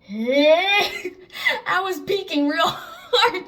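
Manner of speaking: high, loud strained squeal while retelling; hammed-up fright, drawn out and high; mock terrified squeal. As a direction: squeal it out as if scared to look. Acting out terrified reluctant peek.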